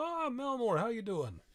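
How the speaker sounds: captain's greeting. Acted.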